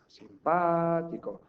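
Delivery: simPAtico with a long, high PA; Simpático, dwelling on PA; correct stress on PA. Shown as a demonstration. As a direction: stretch the PA in Simpático slowly. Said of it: "La sílaba tónica es esta".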